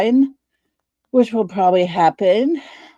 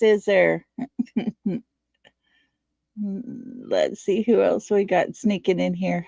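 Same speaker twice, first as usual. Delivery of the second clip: ordinary talk, nothing marked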